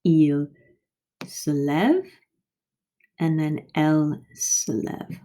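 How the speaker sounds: Il se lève and elle se lève modelled with identical vowel sound; same sound demo. Shown as a demonstration. Teacher to class, modelling identical sounds. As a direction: show they sound alike. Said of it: "it sounds the same".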